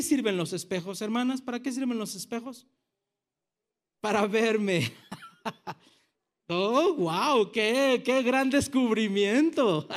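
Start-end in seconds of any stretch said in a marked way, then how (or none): 6.5-9.9 sarcastic mock awe. Mocking the women's obvious answer.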